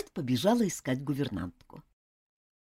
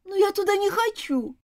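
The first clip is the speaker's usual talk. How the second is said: put-on whiny child. A narrator's reading. Girl whines about school.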